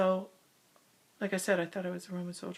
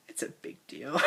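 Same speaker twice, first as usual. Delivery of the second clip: laughing; 5 semitones higher, 8 dB louder than usual. Real, not acted. Laughs at her own claim.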